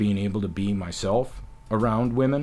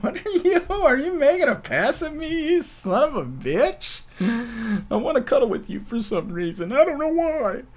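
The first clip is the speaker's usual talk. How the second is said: much higher, louder flustered voice; mock flustered reaction; 6 dB louder than usual. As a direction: play the charmed target, squealing. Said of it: "And I'm like".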